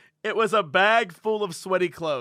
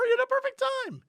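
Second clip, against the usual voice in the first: teasing squeak; 11 semitones higher, 3 dB quieter, faster than usual. Acted. Teasing high voice.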